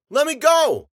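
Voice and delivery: high, loud demand for release; shouted plea. A narrator's reading. Captive imagining shouting at his captors, loud plea. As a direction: yell to be let loose. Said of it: "I could have shouted out".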